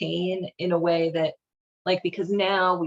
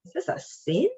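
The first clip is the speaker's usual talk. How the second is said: usual speaking voice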